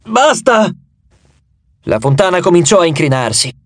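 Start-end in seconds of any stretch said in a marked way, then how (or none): 0.0-0.7 high, urgent cry, restrained volume; shouted. A narrator's reading. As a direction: cry out higher to halt it. (grido acuto e urgente, non a piena voce)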